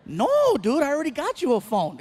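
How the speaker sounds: high, loud drawn "no", then indignant; exasperated dad. Retold or imitated. Dad refusing son a new phone, exasperated. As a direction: protest loudly in disbelief.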